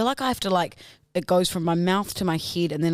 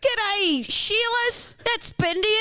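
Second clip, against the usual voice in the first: high Australian voice; Australian accent; 13 semitones higher than usual. Acted. Doing an Australian accent for a friend, high. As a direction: sing out an Aussie g'day, pitched high.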